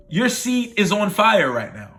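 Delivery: ordinary talk, nothing marked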